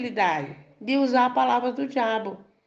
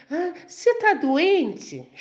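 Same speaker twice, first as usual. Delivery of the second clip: higher, drawn-out mock question; intrometido duvidando; 7 semitones higher, slower than usual. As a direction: imitate a nosy doubter, pitched up and stretched. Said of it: "Aí vem um lá, não sei de onde". (intrometido perguntando em tom agudo e arrastado)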